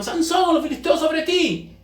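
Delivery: high-pitched alarm call; dramatic high wake-up call; calling out a warning. Acted. Acted warning cry waking Samson.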